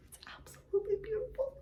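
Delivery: breathless admiring whisper; hushed awe. Real, not acted. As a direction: whisper it like it's too lovely to say aloud. Admiring new fabric.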